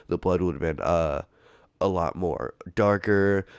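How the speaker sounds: ordinary delivery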